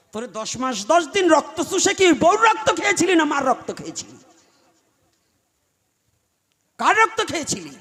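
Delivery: loud, high scolding question, falling at the end; angry rebuke. Acted. Preacher scolding a son who mistreats his mother, acted angry rebuke. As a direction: berate him with a rising accusation.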